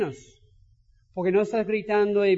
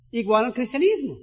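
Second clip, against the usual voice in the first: ordinary delivery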